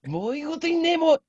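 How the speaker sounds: high, loud, slow anime line; overacted anime dub. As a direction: ham it up like a dubbed anime hero. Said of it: "intense anime character voice".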